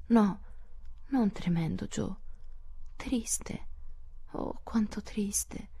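high voice fading to a slow whisper; hushed grief. A narrator's reading. Beth corrects Jo about the baby's death, in hushed sorrow. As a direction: let the sorrow sink into a breath. Beth grieves over the dead baby.

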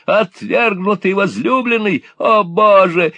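high, loud mocking old devil; devilish glee. A narrator's reading. Gride mocking rejected suitor, devilish high glee. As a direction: gloat like a devil. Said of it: "превратился в сущего дьявола".

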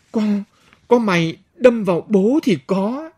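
put-on slurred drunk talking back; drunk slurring. A narrator's reading. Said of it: "Kẻ say vẫn lè nhè".

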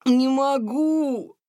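high, slow gasping cry of defeat; breathless cry. A narrator's reading. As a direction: push it out with no breath left. Тихон едва дышал.

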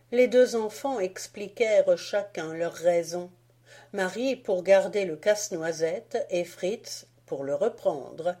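usual speaking voice

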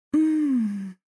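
high, drawn-out "mmm" moan; pleasured moan. A narrator's reading. Moan in acted love scene. (протяжный высокий стон)

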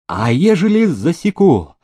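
high, loud threatening question. A narrator's reading. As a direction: challenge her with a threat. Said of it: "Он пригрозил ей". (угрожающе, громко и высоко)

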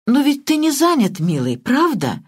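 coy purr of a pampered wife. Acted. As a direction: purr it sweetly, coaxing. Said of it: "Промурлыкала миссис Истербрук". (ласковое мурлыканье)

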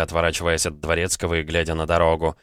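plain reading voice

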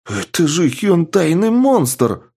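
high, slow excited exclaim; thrilled, eager outburst. A narrator's reading. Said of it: "возбуждённо прокомментировал пироманьяк".

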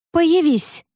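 high, frightened command; scared demand. A narrator's reading. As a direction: try to command but sound scared. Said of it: "скорее испуганно".

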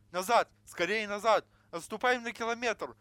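loud, high panicked yell; terrified shouting. A narrator's reading. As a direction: scream the retreat order in terror. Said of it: "закричали в ужасе Мердок и Вертонген".

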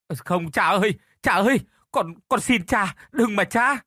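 high, loud sobbing plea; crying out. Acted. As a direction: cry and beg, voice straining. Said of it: "gào khóc đến khàn cả cổ".

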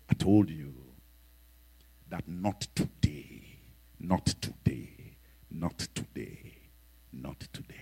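low, slow spirit fading to a whisper; menacing spirit. Retold or imitated. Demonic spirit refusing the bishop, low and fading. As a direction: growl it low, then let it die away.

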